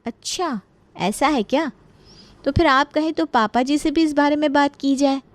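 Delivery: sweet blackmail of the mother-in-law; slyly threatening daughter-in-law. Acted.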